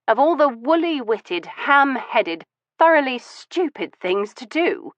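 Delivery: high-pitched exasperated scolding. Acted. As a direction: scold him, almost breaking. Exasperated outburst.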